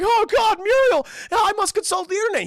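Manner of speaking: high, loud frantic yell; panicked shout. Acted. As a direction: cry out in a cartoon panic. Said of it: "is like".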